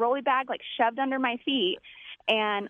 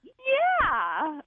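higher, stretched, offhand "yeah"; drawn-out yeah; 8 semitones higher than usual. Retold or imitated. Clerk brushing off her safety worry, long and careless. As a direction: drag it out, carefree. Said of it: "like that to me".

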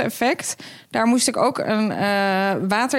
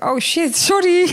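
sudden guilty realization; 8 semitones higher, 4 dB louder, slower than usual. Retold or imitated.

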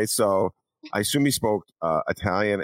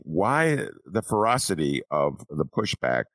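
ordinary delivery

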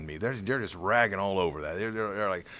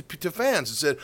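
ordinary talk

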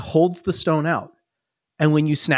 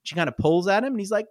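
usual speaking voice

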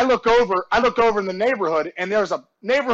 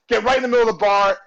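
normal talking voice

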